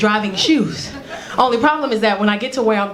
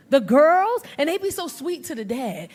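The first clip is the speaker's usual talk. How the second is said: ordinary talk, nothing marked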